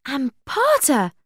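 sudden, high, drawn-out cry of a name; sudden exclamation. A narrator's reading. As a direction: blurt it out, high and slow. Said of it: "said Buffy suddenly".

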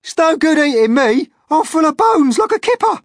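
high, loud frantic pleading; panicked protest. Acted. Earthworm begs not to be eaten, panicked shouting. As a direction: shout a desperate objection. Frightened reply to centipede's shout.

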